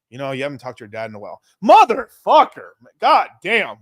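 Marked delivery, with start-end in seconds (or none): 1.6-3.8 slow, high, loud swearing; acted burst of exasperation; exasperated cursing. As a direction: draw the curse out, high and loud. Acts out weed's nagging guilt.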